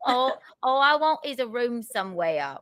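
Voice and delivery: Eliza Doolittle-style mock Cockney; Cockney accent. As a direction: play it as a high-pitched Cockney. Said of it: "My Cockney accent".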